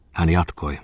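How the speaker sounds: plain reading voice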